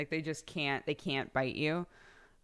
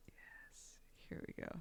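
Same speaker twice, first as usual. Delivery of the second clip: very soft unvoiced whisper; 21 dB quieter than usual. Real, not acted. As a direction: whisper it to yourself. Quiet self-talk.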